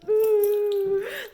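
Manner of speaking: high, loud nervous laugh; high-pitched spooked giggle. Retold or imitated. Retelling her reaction to ghosts.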